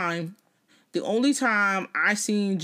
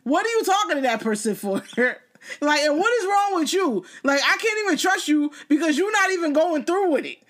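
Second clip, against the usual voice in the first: high, hammed-up scolding of her man; mock scolding; 9 semitones higher than usual. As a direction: scold him in mock exasperation, laughing through it. Reenacting scolding her partner.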